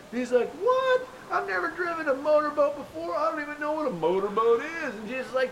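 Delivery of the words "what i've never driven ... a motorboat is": high squeaked What, then fast flustered protest; panicked disbelief. Acted. Peter told to drive the boat, startled and flustered. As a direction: yelp it, then babble in a panic.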